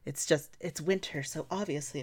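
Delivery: ordinary talk, nothing marked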